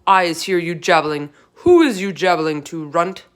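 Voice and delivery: loud, gruff giant calling out; a giant bellowing into a cave; booming giant. A narrator's reading. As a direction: boom it like a suspicious giant.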